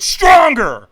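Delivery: very loud, high demand; imitated trainer at full-force yell; trainer's scream. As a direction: bellow it to push them harder. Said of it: "he screamed at me".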